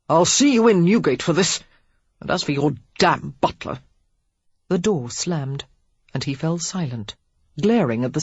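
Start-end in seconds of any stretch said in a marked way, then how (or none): 0.1-3.8 angry shout. A narrator's reading. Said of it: "He shouted a few choice obscenities".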